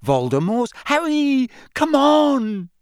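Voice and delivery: very high, loud, frantic cry; desperate urging. A narrator's reading. Hermione dragging Harry away from the Dark Mark, desperate cry. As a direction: wail at your friend to get moving.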